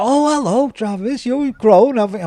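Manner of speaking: high-pitched cooing greeting; fussing elders' greeting. Retold or imitated. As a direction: imitate old churchgoers greeting a grown child. Elders greet him, acted out.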